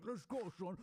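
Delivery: a hint of a gym bro hyping himself; pumped-up hype. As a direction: fire yourself up, lightly voiced. Mock pep talk to self.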